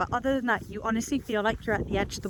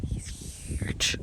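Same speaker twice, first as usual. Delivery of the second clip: hushed breathy aside; awed whisper; 7 dB quieter than usual. Real, not acted. Near a huge kangaroo, she whispers in awe. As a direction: whisper it, afraid he'll hear.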